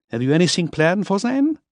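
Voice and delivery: Miller. A narrator's reading. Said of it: "Miller voiced".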